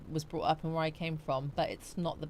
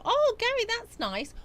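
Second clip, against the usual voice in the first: touched surprise; 5 dB louder than usual. Real, not acted. Touched by a kind gift.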